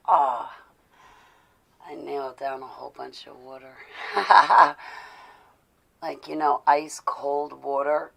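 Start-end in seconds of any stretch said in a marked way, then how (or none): 3.8-5.4 loud laugh. Real, not acted. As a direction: burst of loud real laughter. Laughing at own gulping.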